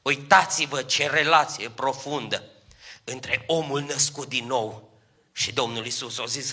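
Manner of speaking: usual speaking voice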